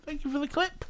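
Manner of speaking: very high falsetto thank-you; squeaky thanks. Pretend. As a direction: put on a tiny high voice. Thanks for clip, then repeats plainly.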